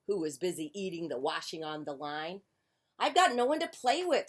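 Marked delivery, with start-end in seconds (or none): 3.0-4.3 high, quick, whiny moan; whiny duck. Acted. Duck moaning to Goat, high and fast. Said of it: "said Duck".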